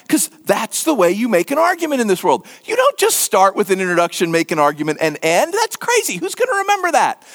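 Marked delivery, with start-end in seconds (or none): 5.5-7.2 high-pitched pretend disbelief; mock incredulous. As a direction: scoff in a much higher voice, as a baffled listener. Acts out baffled ancient listener.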